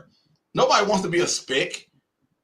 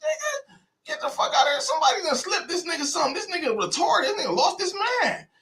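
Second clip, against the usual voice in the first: squeaky high, soft mock outrage, half crying; a hammed-up, whiny disbelief from the family; mock outraged relatives; 4 dB quieter than usual. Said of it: "They go".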